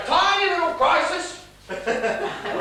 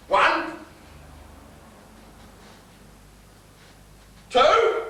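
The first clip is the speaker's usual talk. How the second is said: rising to a shout, count climbing higher; building threat; 5 semitones higher than usual. Acted. Father threatening daughter through the door, building shout.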